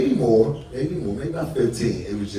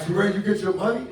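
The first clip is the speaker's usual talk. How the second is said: much higher mock Haitian accent; 11 semitones higher than usual. Will asking the young comic if he got paid, mock Haitian accent. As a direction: ask it in a high, hammed-up Haitian accent. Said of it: "So, Will was like".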